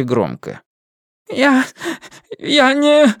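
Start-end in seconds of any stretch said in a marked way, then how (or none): 1.3-3.1 high, stammering babble; babbling. Acted. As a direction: falter in fear. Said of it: "залепетал Гаэтан".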